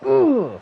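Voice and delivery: sharp high groan of disgust; loud frustrated groan. Real, not acted. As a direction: let out a loud pained ugh. Groaning over missing the cat.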